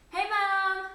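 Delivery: loud, bright hello to mum; calling out. Real, not acted. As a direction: shout a greeting across the house. Greeting mum arriving home.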